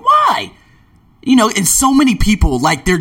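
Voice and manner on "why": high, startled "Why?"; surprised why. Retold or imitated. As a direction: ask it in disbelief. Stranger shocked.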